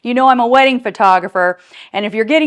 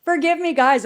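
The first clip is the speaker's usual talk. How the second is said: ordinary talk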